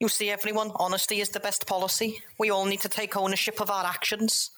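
high, overly polite apology; apologetic Jeff. Acted. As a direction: grovel politely.

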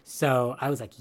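ordinary talk, nothing marked

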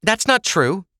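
high-pitched protest. A narrator's reading. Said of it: "Ava protested".

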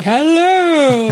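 high, drawn-out doofy hello; doofy Aslan voice. Retold or imitated. Mocking an audiobook lion's voice for the hosts, doofy. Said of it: "he kind of had a doofy voice".

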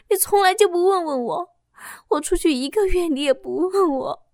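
high, sobbing cry of confession. Acted. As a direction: wail it out from the floor. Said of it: "哭喊着对老公说道". (坐在地上哭喊着向丈夫坦白)

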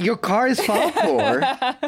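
high and slow, through a laugh; laughing delight. Real, not acted. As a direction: savour the joke while laughing. Laughing at car Falkor joke.

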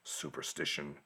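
low, hushed, slow doom voice; ominous warning. Retold or imitated. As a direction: whisper the curse like a threat. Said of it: "Demetrios suddenly says".

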